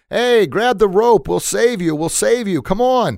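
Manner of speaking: high, loud rescuer's holler; acted yell from a helicopter. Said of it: "yells down".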